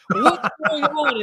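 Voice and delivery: high, slow, drawn-out question; bewildered cry. Retold or imitated. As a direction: act out excited confusion, high-pitched. His confused reply, retold.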